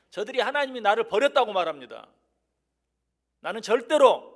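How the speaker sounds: high, very loud declaration; bold prayer. Acted. David rejecting his enemies' words before God, bold loud cry. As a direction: thunder it out in defiant faith. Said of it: "하나님께 담대하게 기도하는".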